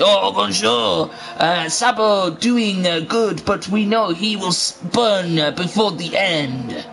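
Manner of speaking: slow, halting mock-French accent; badly faked French accent, drawn out; attempted French accent. Commentator quotes Piero for the replay, clumsy French accent. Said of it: "um, a French accent".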